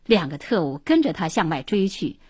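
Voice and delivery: ordinary delivery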